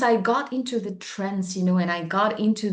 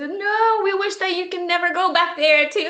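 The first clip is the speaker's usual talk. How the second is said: high, eager insistence; excited; 8 semitones higher, 4 dB louder than usual. Retold or imitated. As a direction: insist warmly, voice lifted high. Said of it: "I told them".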